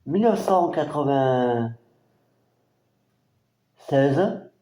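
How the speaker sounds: very slow, drawn-out year; lent. Real, not acted. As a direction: stretch the year out while reading it. Date drawn out while reading. (année traînée très lentement)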